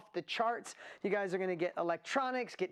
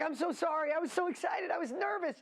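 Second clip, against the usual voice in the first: imitating someone excited and nervous, much higher; excited apology; 9 semitones higher than usual. Host retelling a guest's nervous apology, excited. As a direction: gush a high-pitched mock apology. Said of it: "He was so excited yesterday".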